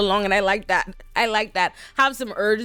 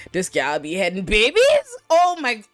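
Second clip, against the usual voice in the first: high, louder scandalised outcry; mock shock; 3 semitones higher, 4 dB louder than usual. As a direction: play appalled townsfolk gossiping in disbelief. Acts out shocked townsfolk.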